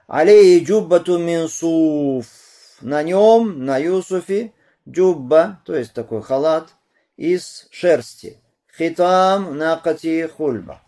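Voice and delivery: high, loud, slow proclamation; solemnly projected, drawn-out narration; hadith recitation. A narrator's reading. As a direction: stretch the words out in a ringing voice. Recites and translates hadith aloud.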